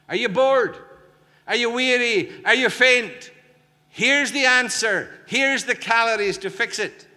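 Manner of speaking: high, loud mock wake-up call; mock rousing shout. Preacher shouting mock questions at a weary congregation, high and loud. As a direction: jolt the dozing crowd awake with a shout.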